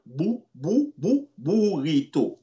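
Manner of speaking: "bu, bu, bu, burrito" with a clear round oo vowel on "bu"; stressing the U sound. Shown as a demonstration. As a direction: isolate "bu" as a pure oo before "burrito". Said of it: "The U sound".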